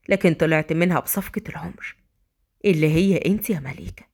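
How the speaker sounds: low, tender male confession; Adham, loving. Acted. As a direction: confess love gently and deeply. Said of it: "أدهم بحب".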